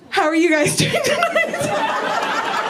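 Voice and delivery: slow, high greeting breaking into laughter; laughing. Real, not acted. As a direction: ask it while fighting off the giggles. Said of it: "this is so hard".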